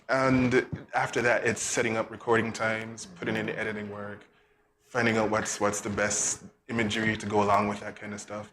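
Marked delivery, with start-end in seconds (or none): none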